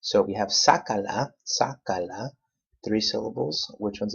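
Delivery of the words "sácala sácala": sácala, punched on SÁ; careful demo of sácala, accent up front; stress on third-to-last syllable. Shown as a demonstration. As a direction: hit the first A of sácala. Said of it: "your accent on the third to the last syllable".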